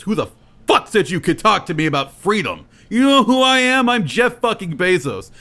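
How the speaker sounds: high, loud, ranting billionaire voice; mock furious outburst. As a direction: blow up at the peasant. Bezos rages at Xander.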